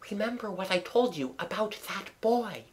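soft, high urgent hush; whispered warning. A narrator's reading. Wife reminds husband of that boy, whispered. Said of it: "Missus Darling whispered".

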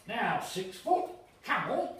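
loud, slow hearty waiter's call; affable waiter. Acted. Waiter greeting the boy at the table, loud and hearty. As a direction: boom it out like a jolly waiter. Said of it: "saying very affably".

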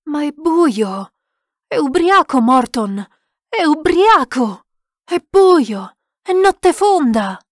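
high, loud, drawn-out protest; panicked shouting. A narrator's reading. Lost man accuses gamekeeper of being drunk, shouting frantically. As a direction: cry out in disbelieving accusation.

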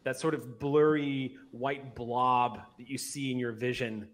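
ordinary talk, nothing marked

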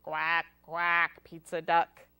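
low quacking duck voice; duck greeting. Acted. Child greeting a pizza duck, in low quacking voice. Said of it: "What if it's a pizza duck?".

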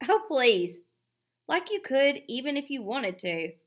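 little sister sneering at her brother; scoffing sister. A narrator's reading. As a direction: brush him off with scorn.

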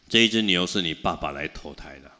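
ordinary talk, nothing marked

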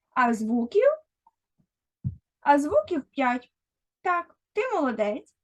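usual speaking voice

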